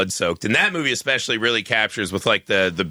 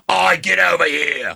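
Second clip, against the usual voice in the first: higher, slow gravelly sailor growl; lightly voiced salty old sailor; dirty old sailor voice; 5 semitones higher, slower than usual. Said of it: "dirty old sailor voice".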